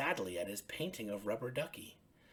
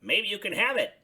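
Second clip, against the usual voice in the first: higher, louder friendly Bert; 9 semitones higher, 9 dB louder than usual. Acted. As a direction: voice Bert lifted up high. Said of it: "said Bert".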